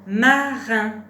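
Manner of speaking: loud, deliberate teacher's model word; syllables held evenly and loudly; word dictation. Shown as a demonstration. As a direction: project each syllable clearly for a learner.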